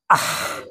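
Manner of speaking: Ah breathed out with no voice, just air; soft voiceless ha from the throat; breathy ha. Shown as a demonstration. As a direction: let the ha out as pure breath. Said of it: "keluarnya napas".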